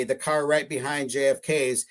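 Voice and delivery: usual speaking voice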